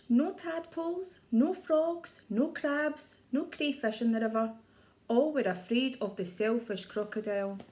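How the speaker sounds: ordinary delivery, nothing marked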